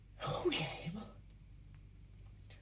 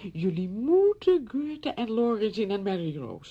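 slow, louder gushing, imitating admirers; quoted enthusiasm; 4 semitones higher, 5 dB louder than usual. Visitor passing on friends' praise, imitated gushing. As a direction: gush it out high and drawn-out, as the friends did. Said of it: "zeiden ze".

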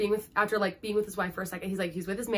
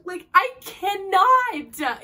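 high, loud and very drawn-out; overwhelmed exclamation; 9 semitones higher, 7 dB louder, much slower than usual. Real, not acted. Book fan overcome by the ending, loud drawn-out exclamation. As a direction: stretch it out in dramatic disbelief.

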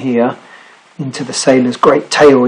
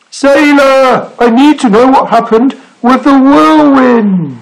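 high, pleading call, stretched at the end; 12 semitones higher, 5 dB louder, slower than usual. A narrator's reading. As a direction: call across the sea for answers. Snorg calls after departed sailor.

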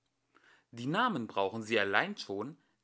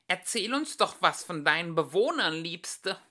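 high, loud, sugary taunt; fluting sweetly; 6 semitones higher, 6 dB louder than usual. Acted. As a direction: coo with mock sweetness. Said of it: "flötete Annette".

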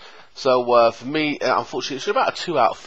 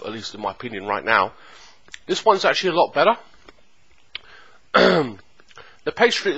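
talking while eating pie; chewing between words; mouth full chewing. Real, not acted. Seen 1: Takes bites of held pastry repeatedly while talking, jaw chewing.

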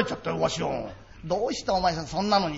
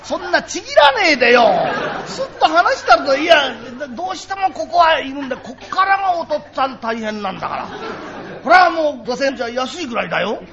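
higher, louder, fed-up father; exasperated; 5 semitones higher, 5 dB louder than usual. Acted. Father to bargaining child, loudly exasperated. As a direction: protest loudly at the price.

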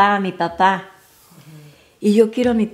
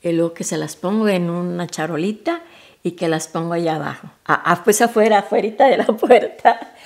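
ordinary talk

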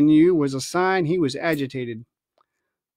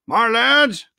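raised, carrying captain's voice; captain addressing the crew; 5 semitones higher, 5 dB louder than usual. Acted. Captain Smollett speaking to the assembled crew, raised and carrying. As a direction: call the whole deck to attention.

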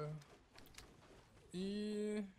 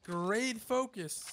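slow, stretched, higher and louder; drawn-out praise; 6 semitones higher, 4 dB louder, much slower than usual. Acted. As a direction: drag out the praise like a cheer. Drawn-out mock praise to teammates.

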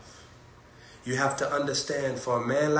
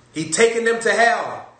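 loud, high cry; 8 semitones higher, 8 dB louder than usual. Acted. Preacher warning the flock, loud and high.

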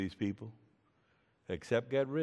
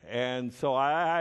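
normal talking voice

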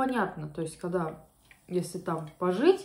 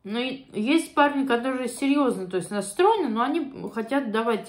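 speaking between bites; chewing while talking; 4 semitones higher, 3 dB louder than usual. Real, not acted. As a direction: mouth partly full of food. Seen 3: Holds fork, took a bite at -5; talks through chewing.

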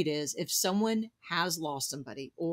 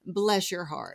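Southern saying; slower than usual. Retold or imitated. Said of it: "when we say".

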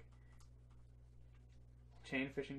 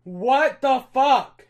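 slow, louder, drawn-out gasp of a question; stunned disbelief; 8 semitones higher, 15 dB louder, much slower than usual. Real, not acted. Streamer reacting to a surprise shiny, slow stunned outburst. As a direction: let it hang in shock.

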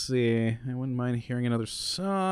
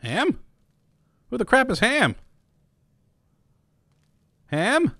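baffled; 10 semitones higher than usual. Real, not acted. Baffled by an odd name.

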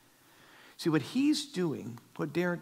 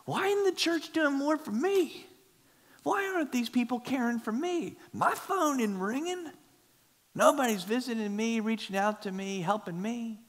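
mock whiny churchgoer; 9 semitones higher than usual. Mocking a self-centred churchgoer.

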